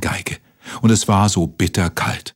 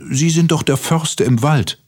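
higher-pitched little cricket, politely coaxing; polite, high-voiced plea; pleading cricket; 8 semitones higher than usual. A narrator's reading. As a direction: speak up high as a small insect asking a favour.

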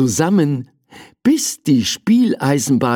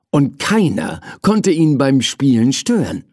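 ordinary delivery, nothing marked

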